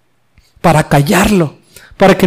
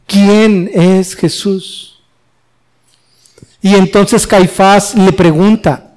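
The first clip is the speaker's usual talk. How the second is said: ordinary delivery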